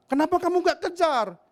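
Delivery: father demanding. Retold or imitated. Father questions son.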